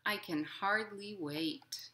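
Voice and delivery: gentle, lilting picture-book reading; reading to children. A narrator's reading. Librarian reading a picture book to children, lilting. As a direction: read it like storytime for little kids.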